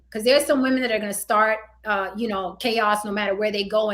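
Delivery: normal talking voice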